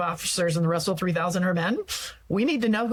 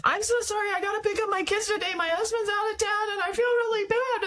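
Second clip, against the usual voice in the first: much higher, fast, sing-song over-apology; apologetic woman; 14 semitones higher than usual. Retold or imitated. The woman apologising for leaving, high sing-song. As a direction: gush out apologies, hammed-up. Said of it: "The woman says".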